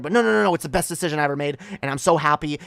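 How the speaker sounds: rushed, overeager mock reassurance; insistent self-convincing. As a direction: bat the doubt away fast, then insist. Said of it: "they have to convince themselves that".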